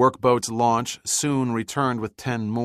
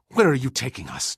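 higher, louder furious demand; angry demand; 6 semitones higher, 5 dB louder than usual. A narrator's reading. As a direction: demand an answer in anger. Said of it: "as angry as Birch had ever seen him".

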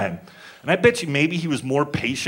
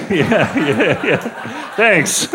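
squeaky high laughing yeahs; laughing mock delight; 6 semitones higher, much slower than usual. Mock father thrilled to be recognized, laughing. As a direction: gush with mock relief through laughter. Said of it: "acting father pleased, recognized".

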